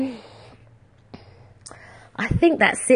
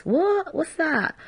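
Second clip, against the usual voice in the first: high squeaky mock surprise; baffled kid; 9 semitones higher, 5 dB quieter, slower than usual. Child asking about the abacus, high baffled. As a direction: play the confused kid. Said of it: "you know, like".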